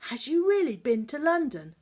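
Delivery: high, loud, awestruck question; wide-eyed wonder; a wife's high voice full of awe. Acted. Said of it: "she asked in awe".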